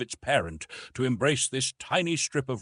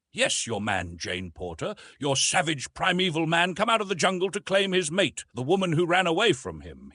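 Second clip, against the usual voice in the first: mild fierceness; 6 semitones higher, 3 dB louder than usual. A narrator's reading. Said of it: "he added almost fiercely".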